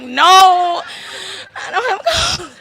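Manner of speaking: high, loud, laughing "no"; exasperated denial. Retold or imitated. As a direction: squeal it out in disbelief.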